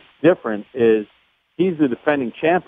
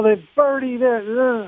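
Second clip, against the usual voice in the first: raised, excited call of a birdie; excited golf call; 12 semitones higher, much slower than usual. Retold or imitated. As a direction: get caught up calling the birdie. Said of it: "I kinda get into it a little bit".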